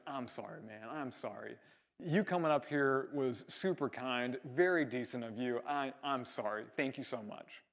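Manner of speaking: low, hushed retold apology; anger subsiding. Surfer stepping back to thank his helper, anger subsiding. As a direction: soften right down into sorry and thanks. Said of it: "the anger subsiding".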